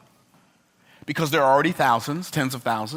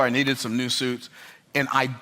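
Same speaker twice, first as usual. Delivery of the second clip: usual speaking voice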